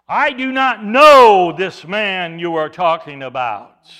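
very loud, slow, vehement denial; cursing denial. Acted. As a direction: thunder it out word by word. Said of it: "he began to curse".

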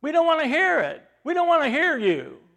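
loud, high, dismissive scolding; annoyed crowd. Retold or imitated. Irritated crowd loudly refuses to listen to Bartimaeus. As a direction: brush the beggar off loudly. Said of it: "That's what they tell them".

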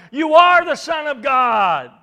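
shouted out at full voice; loud shout. Acted. Unclean spirits falling down before Jesus, shouting. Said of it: "fall down before him and shout".

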